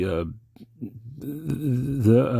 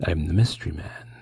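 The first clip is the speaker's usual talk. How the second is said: whispered, menacing mystery man; creepy mystery man; 3 semitones lower than usual. Acted. Playing Robert Blake's mystery man, a creepy whisper. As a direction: breathe it like a sinister stranger. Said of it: "I want to be the mystery man again".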